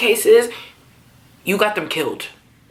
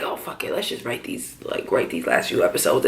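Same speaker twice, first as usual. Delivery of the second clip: whispered, much softer aside; mock whisper; 12 semitones lower, 7 dB quieter than usual. Recapper mocking the show's writers, in a mocking whisper. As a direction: breathe it like a lazy writer's secret.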